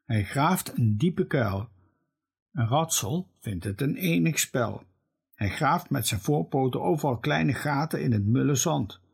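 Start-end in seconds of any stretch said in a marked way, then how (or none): none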